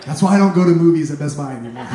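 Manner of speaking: ordinary delivery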